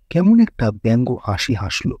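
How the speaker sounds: usual speaking voice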